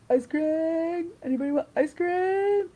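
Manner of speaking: high, loud singsong holler; calling out. Retold or imitated. Friend offering everybody ice cream, high sing-out call.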